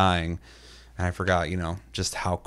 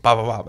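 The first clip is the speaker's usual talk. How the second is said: gunshot noises; 3 semitones higher, 6 dB louder than usual. Retold or imitated. Mocking the enemy he guns down, with bopping gunshot noises. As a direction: mouth gunfire, punchy bops. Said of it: "and I just went".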